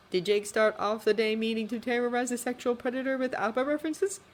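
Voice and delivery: plain reading voice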